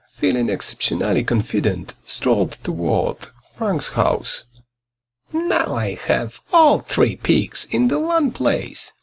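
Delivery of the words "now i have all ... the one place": higher, gloating wolf exclaiming. A narrator's reading. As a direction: crow over the catch, pitched up. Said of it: "exclaimed the big bad wolf".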